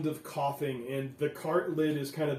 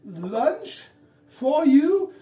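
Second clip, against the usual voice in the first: higher, hesitant pretend-polite delivery; familiar woman's voice; 10 semitones higher, 6 dB louder, slower than usual. Disguised woman offers lunch to the gate workers, higher voice. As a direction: feign sweet official politeness. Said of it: "a voice that sounds somewhat familiar".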